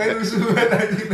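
tertawa lepas sambil mengumpat. Real, not acted. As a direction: bicara di sela tawa. Mocking rough players, laughing. (tertawa keras)

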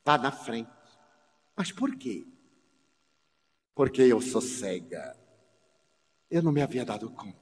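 low, hushed telling of her blindness; soft quiet voice. Retold or imitated. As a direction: speak softly, almost withdrawn. Voz de Cláudia, cega, baixa. (voz baixa e contida)

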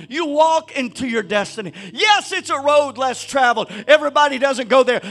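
loud, driving sermon build; preaching at full force. Acted. Pastor urging his church to walk in faith, full force preaching. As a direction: preach it up, pressing on every walk.

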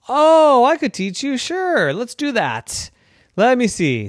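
high, delighted offer; a neighbor thrilled to help; eager old neighbor. Acted. Joe eager to teach.

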